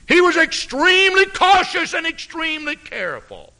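high, loud preacher's cry; full-force preaching. Real, not acted. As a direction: lift it up and ring out like a revival preacher.

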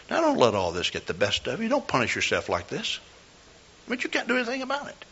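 soft, hushed comfort; quiet word in her ear; retold whisper. Pastor consoling the angry lady in line, soft hush. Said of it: "I whispered to her".

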